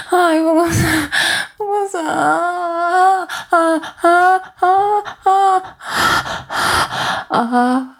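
acted climax moaning to a lover; climax moans. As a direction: build to a peak on each breath.

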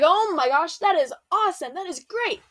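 high, thrilled outburst, voice jumping up; leaping for joy at God's promise; excited Abram. Acted. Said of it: "So Abram is leaping".

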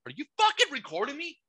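outraged squawk without full volume; retold yell. Re-enacting outburst at neighbor recording him, mock yell. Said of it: "I start yelling at him".

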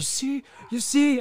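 high, loud taunting "you see"; mocking shout. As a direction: jeer it like an angry fan. Fans mocking the slow sub.

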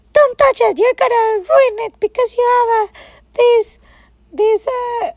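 high, loud mock scolding; scolding friend. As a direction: imitate the bossy friend. Friend voiced scolding her.

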